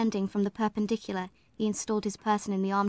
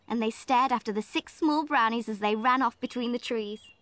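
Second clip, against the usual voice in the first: plain reading voice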